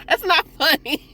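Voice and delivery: high laughing protest; giggling denial. Real, not acted. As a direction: laugh while saying it isn't funny. Laughing at choking scene.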